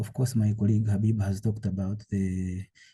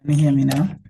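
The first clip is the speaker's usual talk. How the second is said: louder, higher and clearer; projecting so everyone hears; speaking up; 5 semitones higher, 9 dB louder, faster than usual. Real, not acted. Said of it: "Please speak up".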